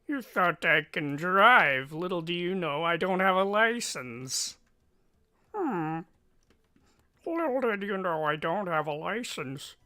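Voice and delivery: high, silly put-on voice; funny voice. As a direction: crow it in a goofy high voice. Playing around in funny voices.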